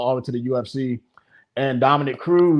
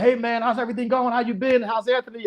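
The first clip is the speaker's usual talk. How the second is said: friendly catch-up; 9 semitones higher than usual. Retold or imitated. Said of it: "He was like".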